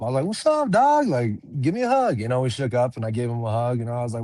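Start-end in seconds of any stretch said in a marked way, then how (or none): 0.3-2.2 lit-up greeting. Retold or imitated. Fighter retelling greeting his rival at weigh-ins, excited and bright. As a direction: greet him like an old buddy, all lit up. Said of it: "I lit up".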